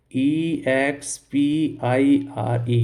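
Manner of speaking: slow, spaced-out letters; spelling out. Shown as a demonstration. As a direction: draw out each letter as you spell. Teacher spells out the word. (धीरे-धीरे अक्षर अलग करके बोलना)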